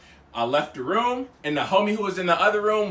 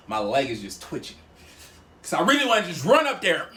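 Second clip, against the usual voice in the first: usual speaking voice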